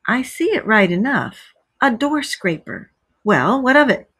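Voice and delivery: usual speaking voice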